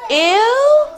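high, grossed-out squeal; disgusted ew. Acted. Reacting to eating flies, disgusted. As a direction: recoil in disgust.

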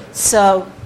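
ordinary delivery

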